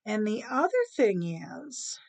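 slow, deliberate concluding narration; storyteller's ending. A narrator's reading. Storyteller wraps up cat and rat tale, measured. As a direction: draw out each phrase with spaced pauses.

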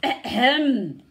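loud booming throat-clear; rumbling ahem. Acted. Lion in the scrub announcing himself with a loud booming ahem. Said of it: "A rumbling voice came from the scrub".

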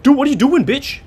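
loud, high outraged shout; real frustrated shout; exasperated yell. Real, not acted. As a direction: yell at him in disbelief. Yelling at game enemy.

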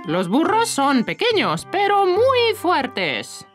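"muy fuertes" high and stretched out; slow, swooping rise onto a long, high "muy"; emphatic praise. Acted. Owner proudly tells child about donkeys, "muy fuertes" drawn out high.

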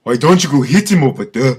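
put-on Nigerian accent, laughing. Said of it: "I'll do a Nigerian accent".

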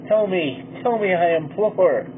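plain reading voice

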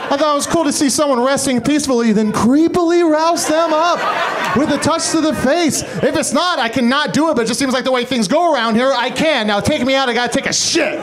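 high, sheepish dog talking. Retold or imitated. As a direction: play the dog, sheepishly explaining itself. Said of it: "the dog's gonna be like".

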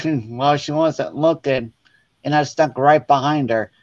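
normal talking voice

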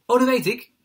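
loud, high, eager interjection; eager know-it-all. A narrator's reading. Another child chimes in eagerly.

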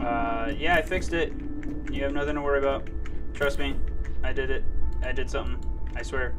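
loud, defensive robot ranting; angry robot voice. Acted. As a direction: bluster loudly like a guilty robot.

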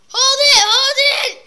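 high, loud hold it; panicked shout. Real, not acted. Gamer yelling at the game to stop, panicked.

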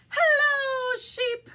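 high, loud storybook duck hailing a friend; calling out a greeting. Acted. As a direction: sing out a big hello as the duck. Duck calls to Sheep in story.

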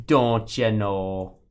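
slow, drawn-out fake Canadian catchphrase; teasing Canadian "don't you know"; mock Canadian accent. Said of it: "I like it when Canadians".

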